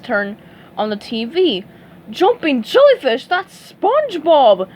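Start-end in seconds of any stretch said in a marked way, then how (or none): 2.1-4.7 higher, louder startled exclamation; shocked at the TV; surprised cry. Acted. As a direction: Mr Krabs seeing SpongeBob on TV. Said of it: "Mister Krabs cried".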